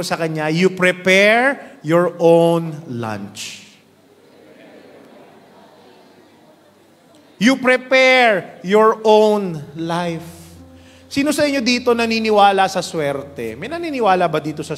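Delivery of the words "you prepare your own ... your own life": very slow, deliberate, louder punchline; preacher's line told to the neighbour. Acted. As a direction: land each word slowly for effect. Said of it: "punchline delivered slowly".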